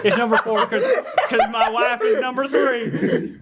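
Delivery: high, talking through laughter; helpless laugh running through the words. Real, not acted. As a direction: crack up while blurting it out. Father cracks up ranking his family.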